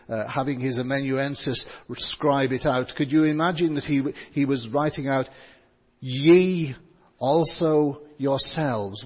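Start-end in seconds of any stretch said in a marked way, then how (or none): 6.0-9.0 slow, deliberate weight on "Ye also yourselves"; emphatic. Shown as a demonstration. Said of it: "Why do you think it's so emphatic".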